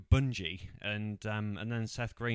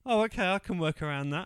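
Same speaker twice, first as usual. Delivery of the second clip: high, louder breezy put-on voice; mock cheerful woman; 8 semitones higher, 6 dB louder than usual. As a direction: shrug it off brightly in a higher voice. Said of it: "she goes".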